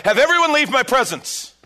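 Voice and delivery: loud high commanding cry; cried out. Acted. Joseph ordering his attendants out, a loud high cry. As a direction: bellow the order to clear the room. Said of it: "He cried out".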